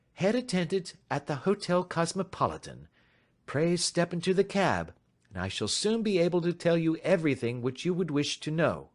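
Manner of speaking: polite and in command; composed detective. A narrator's reading. Detective inviting the stranger to talk, lightly voiced calm.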